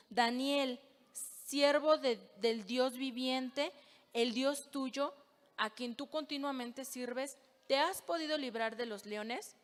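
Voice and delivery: ordinary delivery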